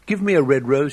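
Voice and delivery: calling out. Acted. Nightingale crying out to the rose tree for a rose. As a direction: call up to the tree, begging. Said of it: "she cried".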